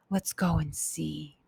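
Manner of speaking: hushed. A narrator's reading. Boys deciding quietly to investigate.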